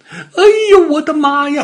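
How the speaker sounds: high, loud terrified little-dog yelp; scared out of wits. A narrator's reading. Little dog spots a fierce tiger, scared out of wits. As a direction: cry out in a very high panic. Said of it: "小花狗吓得魂儿都飞了".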